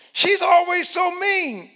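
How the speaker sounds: high shouted complaint; indignant shout. Acted. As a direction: yell the grievance. Offended person complains.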